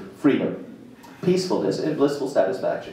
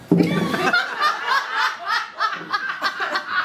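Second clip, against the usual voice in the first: long high burst of laughter; big laugh. Real, not acted. Teacher teasing listeners about the symbol, laughing high. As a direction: break into delighted laughter.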